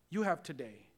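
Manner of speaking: ordinary talk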